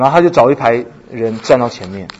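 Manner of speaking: ordinary talk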